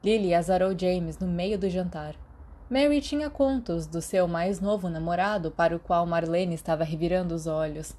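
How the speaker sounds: usual speaking voice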